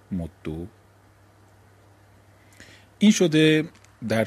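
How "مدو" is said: «مدو» said mod-do, doubled d then "o"; damma ending. Shown as a demonstration. Teacher closing the list, «مدو» said softly as mod-do. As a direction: drop lower and softer on «مدو», ending in "o".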